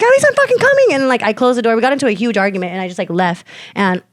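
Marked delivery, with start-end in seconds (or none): 0.0-0.9 high-pitched, indignant retold yell; mad retort. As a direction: re-enact an angry protest, pitched way up. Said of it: "mad, and I was like".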